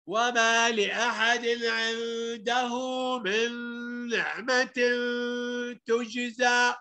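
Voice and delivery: slow tajweed chant; Quran recitation. Acted. Teacher modelling a verse for pupils, slow recitation. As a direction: recite slowly, holding the long sounds.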